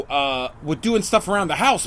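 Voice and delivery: ordinary talk, nothing marked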